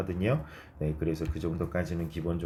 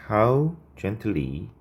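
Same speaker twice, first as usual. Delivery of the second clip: drawn-out, slow and a bit higher; slow dictation; 4 semitones higher, much slower than usual. Shown as a demonstration. Teacher slowly dictating the corrected phrase to students. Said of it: "how가 맞겠죠".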